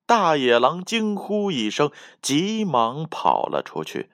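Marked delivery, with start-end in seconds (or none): none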